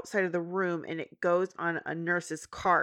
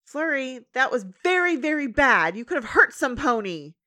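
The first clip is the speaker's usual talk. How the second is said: higher, louder scold; scolding Twilight; 6 semitones higher, 5 dB louder than usual. Retold or imitated. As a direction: tell the baby off.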